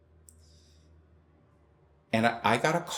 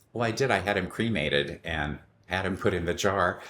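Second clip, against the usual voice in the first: usual speaking voice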